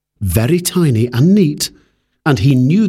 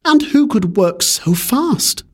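higher, slow amazed question; wondering; 10 semitones higher than usual. Acted. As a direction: ask it in disbelief. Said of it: "he wondered".

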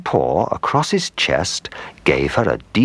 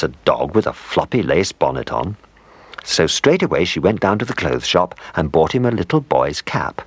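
ordinary delivery, nothing marked

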